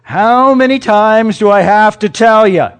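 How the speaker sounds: loud, high coach's yell of frustration; hammed-up coach shouting at his player; exasperated coach. Coach scolding a player who keeps missing blocks, loud exasperated yell. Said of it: "And the coach says".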